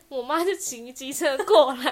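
loud, slow, laughing; cracking up at the memory. Real, not acted. Laughing as she recalls mom riding up on the scooter.

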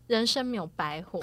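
ordinary delivery

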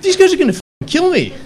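panicked realization. Retold or imitated. Imagining his killers on the volcano, rising panic. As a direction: let the panic build up high and loud. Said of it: "and I'm like".

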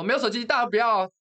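reenacted angry yell; retold scolding. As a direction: lash out at the group again. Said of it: "我就大骂".

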